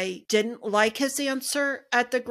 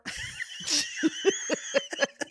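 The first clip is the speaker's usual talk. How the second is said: burst of laughter; 3 dB quieter than usual. Real, not acted. Bloopers after outro.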